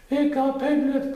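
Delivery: usual speaking voice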